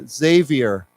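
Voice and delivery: slow "Xavier" starting with a Z; "Xavier" drawn out, opening on a buzzed Z; Z pronunciation. Shown as a demonstration. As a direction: model "Xavier" slowly as ZAY-vee-er. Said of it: "It's pronounced with a Z".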